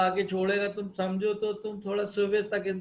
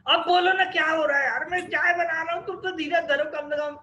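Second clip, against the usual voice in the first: exasperated husband; 6 semitones higher, 5 dB louder, faster than usual. Acted.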